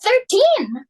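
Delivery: dismayed exclamation. Acted. Hostess despairing at thirteen guests, high dismayed cry. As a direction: throw up your hands at the number.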